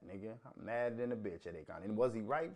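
ordinary delivery

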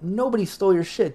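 high-pitched disbelieving protest; incredulous. Retold or imitated. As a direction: throw the accusation back in his face. Disbelief at accusation of theft.

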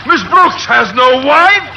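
shrieking to be saved; desperate pleading. Acted. Captain begs frantically.

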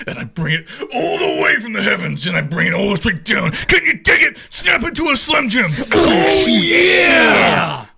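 high, loud shouted promo ending; Macho Man shout. Retold or imitated. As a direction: build to a roaring Oh yeah. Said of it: "this deep Chicago accent".

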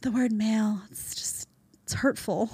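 breathy whispered mock fragility; mock hurt whisper. Host imitating oversensitive men in a mock hurt whisper. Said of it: "there are guys that talk like this".

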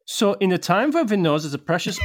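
comic attempt at a Swedish accent, pitched high; badly faked Swedish accent drifting German. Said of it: "how do you do a Swedish accent".

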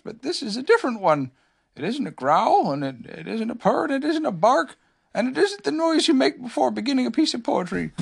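high-pitched bear thinking aloud; puzzled Pooh. A narrator's reading. Pooh puzzles over the strange noise, high voice. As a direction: work it out in a high storybook voice.

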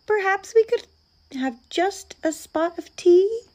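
high, small-creature voice; hopeful, high-voiced request; polite little mouse. A narrator's reading. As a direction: pipe up politely as a tiny mouse. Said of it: "said the mouse".

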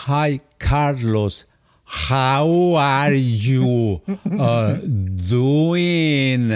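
very slow, exaggerated Mexican-accented English; drawn-out taunting parody of a Mexican accent; mocking Mexican accent. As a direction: mimic a Mexican accent mockingly, dragging every word. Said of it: "me lo repetía burlándose, ¿me entiendes? Del acento".

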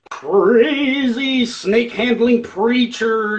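slow, high, drawn-out preacher voice; hammed-up snake-handling preacher; crazy preacher. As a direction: stretch it out like a wild preacher. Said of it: "like, the character to sing the songs".

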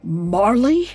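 shaky disbelief. A narrator's reading. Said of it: "Scrooge's voice shook in disbelief as he muttered".

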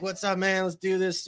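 high-pitched, hyped-up comic greeting; Kevin Hart. Retold or imitated. Comic imitates an energy comic greeting a crowd, excited high voice. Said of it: "like, your Kevin Hart".

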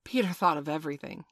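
ordinary delivery, nothing marked

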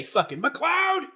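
hammed-up summons; mock angry boss. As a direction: call out the name slowly in mock outrage. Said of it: "I was just about to say".

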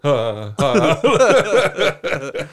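high, loud dog panting with a laugh. Retold or imitated. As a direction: pant like an excited dog at the window. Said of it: "I'm like a dog".

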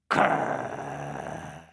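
deep low growl; dog growl. Acted. Dogs chasing monkey, deep growl.

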